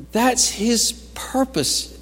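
high, slow, emphatic proclamation; preach. Acted. Preacher voicing the writer of Hebrews, high emphatic preaching. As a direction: stretch it out high with conviction.

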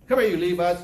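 loud call to the troops; forceful acted order; commanding summons. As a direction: call them over like an angry leader. Acts Moses summoning Levites.

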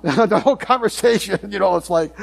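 amused, talking through a laugh; real laughter in the voice. Real, not acted. Laughs at the child's questions.

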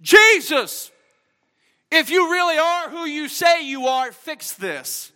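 high, loud entitled demand; mock demanding. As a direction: sneer it like a demand owed to you. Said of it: "Bartimaeus didn't say".

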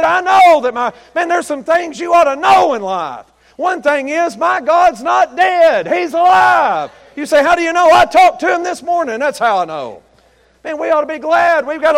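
high, loud, fervent preaching; preaching at full force. Acted. Preacher rousing the congregation, shouting fervently. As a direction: lift the whole church with it.

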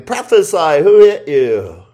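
high, very loud taunting demand; loud command. Acted. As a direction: fire it out as a soldier's order. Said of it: "commanding him".

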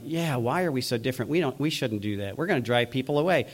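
complaining churchgoer. Acted. Newcomer objecting to the church being different, softly voiced complaint. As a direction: quietly object as a doubtful newcomer. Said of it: "were coming into the church going".

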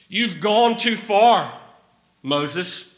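high, loud, archaic King James declaiming; Old English voice. Retold or imitated. Preacher voicing Korah defying Moses, old English declaiming. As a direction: proclaim it like an old Bible rebel. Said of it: "I don't know why I'm talking in Old English".

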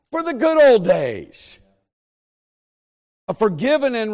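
loud, rising preacher's cadence; fiery preaching. Acted. Pastor urging the congregation to serve God, loud and fervent.

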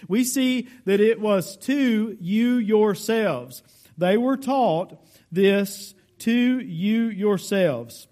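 slow, leaning on "you yourselves"; emphatic. Shown as a demonstration. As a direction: press each word of "you yourselves" slowly. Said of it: "It's emphatic".

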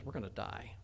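hushed, hopeless murmur of doomed men; despairing. Acted. A frightened spy telling the people, hushed despair. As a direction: let it drop to almost nothing.

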